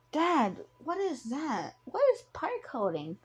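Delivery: put-on little kid asking Dad in wonder; curious child.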